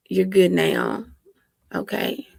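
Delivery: ordinary talk, nothing marked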